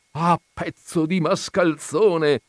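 high, slow, loud insult; outraged shout. A narrator's reading. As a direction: cry out at the scoundrel in outrage. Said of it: "gridò la vedova". (grido indignato)

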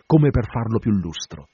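ordinary delivery, nothing marked

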